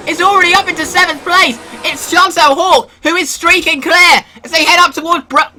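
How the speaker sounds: high, fast, excited race-start call; hyped race commentary. Acted. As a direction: call the start like a live broadcaster.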